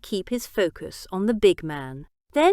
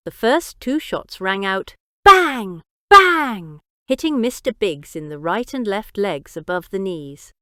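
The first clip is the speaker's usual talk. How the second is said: ordinary delivery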